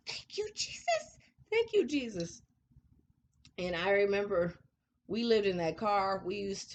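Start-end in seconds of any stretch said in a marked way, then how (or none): 0.1-2.4 high, breaking cry of thanks; tearful thanks. Real, not acted. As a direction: cry out in grateful tears. Overwhelmed gratitude, crying out.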